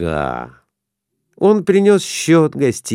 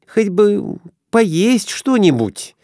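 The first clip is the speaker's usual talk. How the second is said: high hungry wife's voice; Glafira's voice; 8 semitones higher than usual. A narrator's reading. Wife wistfully asking her husband for a bite to eat. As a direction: ask wistfully for food for the road. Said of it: "сказала Глафира Семёновна".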